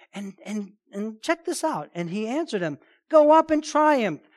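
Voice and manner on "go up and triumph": triumphant command. Retold or imitated. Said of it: "giving in".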